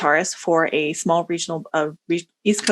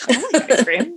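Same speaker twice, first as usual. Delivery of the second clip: playful; 7 semitones higher than usual. Retold or imitated. Echoed quote, high laugh.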